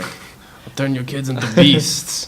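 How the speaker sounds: slow, heavy fake Russian accent. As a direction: growl it like a tough Russian coach with a thick Russian accent. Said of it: "That's my terrible Russian accent".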